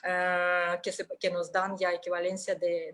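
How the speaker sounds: ordinary talk, nothing marked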